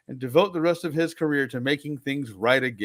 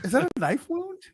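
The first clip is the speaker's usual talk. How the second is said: shocked question; 10 semitones higher than usual. Pretend. Shocked at the stabbing.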